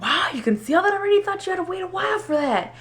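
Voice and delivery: high, quick wide-eyed wow; mock amazed. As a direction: sound genuinely floored by the scan. Stunned at the ultrasound.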